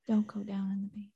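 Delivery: fast unvoiced whisper. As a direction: whisper it fast like a spooky warning. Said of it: "very quietly".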